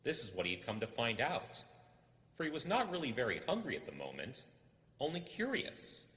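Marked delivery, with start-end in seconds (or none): none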